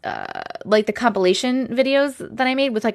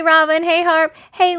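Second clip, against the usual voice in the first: usual speaking voice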